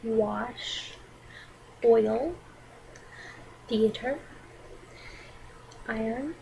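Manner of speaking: usual speaking voice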